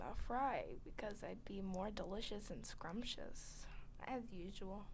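smug little whisper. Pretend. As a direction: whisper it, pleased with yourself.

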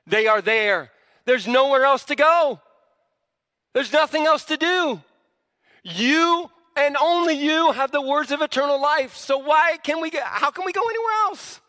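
high, loud, climbing fervour; impassioned conviction. Retold or imitated. Disciples insisting there's nowhere else to go, impassioned. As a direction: build to an incredulous high-pitched plea.

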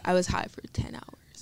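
hushed whisper. Real, not acted. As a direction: whisper it like a secret. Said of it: "Why are you whispering?".

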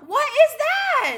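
very high, loud, drawn-out disbelief; exasperated outburst. Acted. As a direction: cry it out slowly in outraged disbelief. Exasperated reaction to the story.

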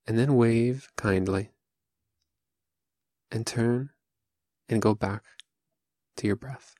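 calm, unhurried meditation voice with long pauses; guided meditation. Acted. Teacher leading listeners through seated Zazen, calm guided meditation. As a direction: guide them gently, phrase by phrase, leaving room to breathe.

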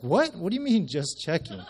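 high, loud outburst easing off with a laugh; incredulous. Retold or imitated. Pastor answering God outside the diner, incredulous. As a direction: protest in comic disbelief.

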